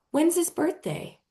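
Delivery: put-on high, curious little-girl question; light girl voice. Playground girl asking about the brother, lightly childlike. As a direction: ask it brightly like a kid.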